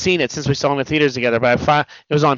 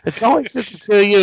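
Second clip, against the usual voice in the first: higher-pitched mock complaint, speeding up; retold bitching; 7 semitones higher than usual. Retelling Deadpool griping about missing X-Men, higher mock whining. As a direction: imitate a movie hero griping, pitched up. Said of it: "where he's bitching".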